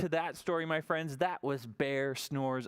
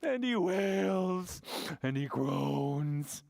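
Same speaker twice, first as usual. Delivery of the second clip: slow, higher, moaning narration; whimpery read; 6 semitones higher, slower than usual. A narrator's reading. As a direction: moan each word. Reading crying sounds.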